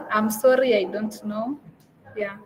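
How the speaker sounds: usual speaking voice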